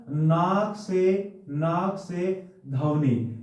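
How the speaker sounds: very slow, drawn-out words; slow emphatic teaching. Shown as a demonstration. Teacher drills where the sound comes from, slow and emphatic. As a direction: stretch each word out for the class.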